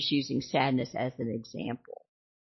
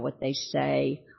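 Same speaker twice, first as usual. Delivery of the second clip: ordinary talk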